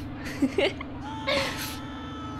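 unvoiced squealing giggle; real helpless high laugh; high wheezing laugh. Real, not acted. Gamer cracks up at guests screaming on the ride, wheezing.

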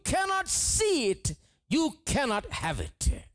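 rising high, slowing on key lines; building preaching. Real, not acted. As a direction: climb to a fervent peak.

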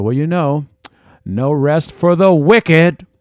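a hammed-up echoing boom of an ordinary remark; amplified, booming voice. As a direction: stretch the words out loud and high as if they were amplified. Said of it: "That's the way it sounds to you".

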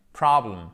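Problem with an English short o, not French problème. Shown as a demonstration. Teacher shows French learners the English Problem. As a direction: say Problem the English way, stress on the first syllable. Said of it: "on a problem et pas problème".

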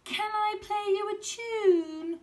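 put-on high, slow eager boy; high slow eager kid; little boy's voice. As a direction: ask sweetly like a small boy. Boy asks to play.

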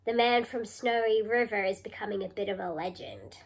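ordinary talk

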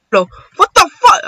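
high, loud startled outburst; outraged disbelief. Real, not acted. Gamer thrown by a mishap, high loud exclamation of disbelief. Match suddenly goes wrong.

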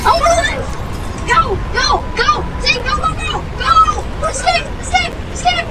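very high frantic screaming; panicked screaming. Real, not acted. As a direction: flee for your life, shrieking. Fleeing tornado in game.

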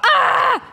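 very high-pitched yelp back; mock scream. Yelling back at him.

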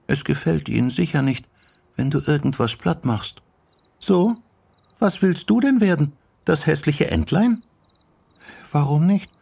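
put-on high girlish back-and-forth; bantering young girls. Sachiko and Yoko tease about growing up, playful.

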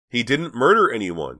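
loud, high outburst; shouting. A narrator's reading. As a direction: burst out at the table. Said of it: "Dina shouted".

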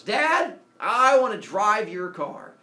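loud, high start, drawn-out request; eager demand. Acted. Teenage son asking for the sun chariot, loudly eager. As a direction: announce the big wish, stretching it. Teen blurts out his big wish.

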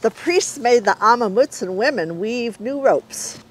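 ordinary delivery, nothing marked

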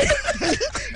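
high laugh that almost cries; high laughing squeal. Real, not acted. Host laughs at story.

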